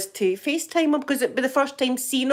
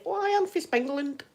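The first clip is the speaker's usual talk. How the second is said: put-on higher, playful made-up Spanglish accent; 8 semitones higher, 4 dB quieter than usual. Joking to viewers she is from Spangland, mock accent. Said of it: "that's my accent".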